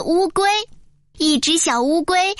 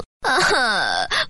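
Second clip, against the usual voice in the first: low, acted whimper of pain; hurt, drawn-out moan; pained groan; 6 semitones lower than usual. A narrator's reading. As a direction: groan after a hard fall. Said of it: "痛得咧着嘴说".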